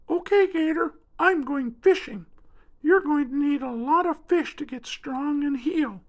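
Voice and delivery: very high, light bird voice; spoonbill voice. Acted. Spoonbill introduces himself to the hurt alligator, birdlike. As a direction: chirp it like a small bird. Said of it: "Stanley said".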